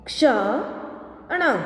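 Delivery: slow, each sound drawn out, rising at the end; slow drawn-out reading. Shown as a demonstration. Teacher slowly sounding out a word for students, drawn out. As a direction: stretch the word slowly for the class. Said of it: "अब इसका शब्द हम देखें".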